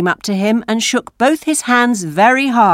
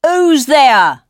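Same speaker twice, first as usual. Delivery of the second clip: loud, raised challenge; crying out; 6 semitones higher, 5 dB louder than usual. A narrator's reading. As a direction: call out sharply to an unseen visitor. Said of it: "a voice cried out".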